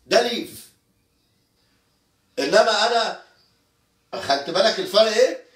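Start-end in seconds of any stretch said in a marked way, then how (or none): none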